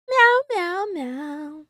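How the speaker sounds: high playful cat meows that fade out; meowing. Pretend. Streamer meowing at chat like a playful cat.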